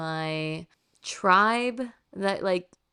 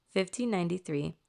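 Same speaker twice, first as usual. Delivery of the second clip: ordinary delivery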